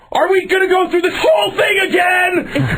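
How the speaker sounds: very high, strained exasperated shriek; acted high-pitched meltdown; re-enacted screaming. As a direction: scream it like a customer at breaking point. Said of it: "I start screaming".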